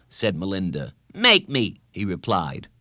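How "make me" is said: high, loud schoolboy dare; punchy kid's defiance; defiant boy. A narrator's reading. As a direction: throw it back as a challenge. Boy defying a girl.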